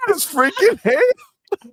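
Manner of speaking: high, slow, drawn-out indignant cry; mock outraged shout. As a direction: stretch it out in fake outrage. Mock outrage about Canadian bacon.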